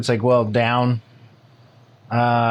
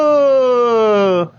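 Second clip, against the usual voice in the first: very high, loud ghost cry, Dracula-ish; ghost noise; 11 semitones higher, 5 dB louder than usual. Pretend. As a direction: let out a spooky ghost howl. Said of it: "You do your ghost noise".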